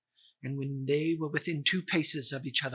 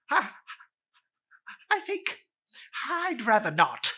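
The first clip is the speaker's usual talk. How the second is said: nervous reluctance; 5 semitones higher than usual. Acted. Scrooge balks at spirits.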